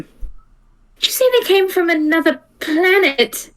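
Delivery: ordinary talk, nothing marked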